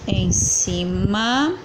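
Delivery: "em cima" drawn out very slowly as em-ci-ma; syllable by syllable. Shown as a demonstration. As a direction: stretch each syllable of "em cima", rising at the end. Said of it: "Separação primeiro". ("em cima" bem devagar, separando as sílabas)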